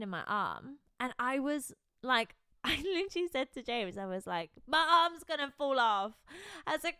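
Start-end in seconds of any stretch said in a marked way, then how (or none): 4.7-6.1 higher, louder mock whine; over-dramatic moan to a partner; hammed-up distressed complaint. Retelling own dramatic complaint to James.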